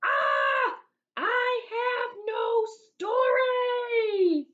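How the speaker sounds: high, slow, desperate wail; loud anguished cry, drawn out; yelling. Acted. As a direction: wail it like a panicked kid. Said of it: "So there he is yelling".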